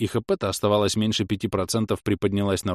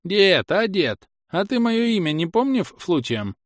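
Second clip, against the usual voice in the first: high-pitched granny voice; bewildered old woman; 11 semitones higher, 4 dB louder, slower than usual. Acted. Old woman asking her husband her name, high bewildered voice. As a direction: call out high, slowly, confused.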